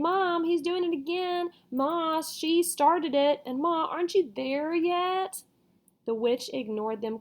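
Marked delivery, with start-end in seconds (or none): 0.0-5.4 put-on high, whining kids complaining; children tattling to their mother; whiny children. A narrator's reading. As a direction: nag Mom like bratty kids, drawn out. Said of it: "As in".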